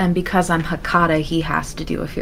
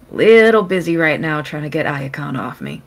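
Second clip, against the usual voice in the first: louder, strained with effort; 6 dB louder than usual. Acted. Marcelina over the earpiece, busy fighting Iacon off, strained. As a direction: say it through a struggle. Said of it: "in a strained voice".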